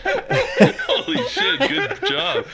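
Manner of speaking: high helpless laughter; cracking up, squealing; laughing hard. Real, not acted. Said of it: "you can barely even speak like a human".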